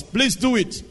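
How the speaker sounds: ordinary delivery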